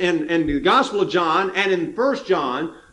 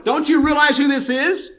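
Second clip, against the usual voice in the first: high, insistent son of thunder; fiery disciple insisting this is the truth; intense indignation; 6 semitones higher than usual. Retold or imitated. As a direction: press it hard, incredulous. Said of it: "is kind of what he's saying".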